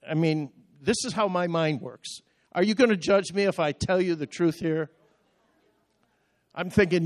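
ordinary delivery

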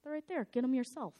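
imitating a dismissive cashier; rude cashier. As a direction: toss it off like a rude clerk.

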